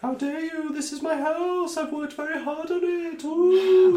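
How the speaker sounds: indignant wraith, very high wail; ghostly voice. Acted. Offended marsh wraith defending its house, high ghostly wail. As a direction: protest in a high ghostly wail, swelling at the end. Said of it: "in his ghostly voice".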